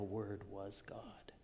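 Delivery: whisper. Acted. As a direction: drop to a reverent whisper. Climax of quoted prologue.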